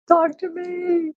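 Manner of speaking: very high, drawn-out plea of "Talk to me!"; pleading mom. Acted. Mom begging her teenage son to talk, high and slow. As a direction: stretch "Talk to me!" slowly up high like a begging mother.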